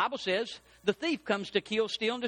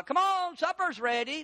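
high, moderate call to the family; mama calling to supper; 8 semitones higher than usual. Acted. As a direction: call them to the table without yelling yet. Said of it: "tell you why your mama yells".

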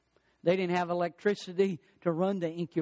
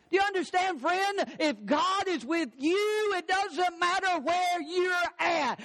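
high, slow, fervent preaching; 10 semitones higher, slower than usual. Acted. Pastor presses the point to his church, fervent and slow.

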